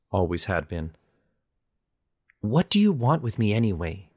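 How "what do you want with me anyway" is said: mildly heated, insistent question; demanding. A narrator's reading. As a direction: press her for an answer, annoyed. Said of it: "I demanded".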